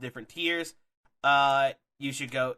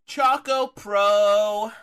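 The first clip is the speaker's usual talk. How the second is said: stretched out, loud and high; announcer-style reveal; 7 semitones higher, 9 dB louder, much slower than usual. Pretend. Co-host revealing next week's promotion, big drawn-out announcement. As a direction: draw the name out like a ring announcer.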